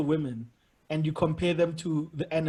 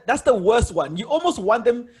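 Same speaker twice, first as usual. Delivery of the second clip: normal talking voice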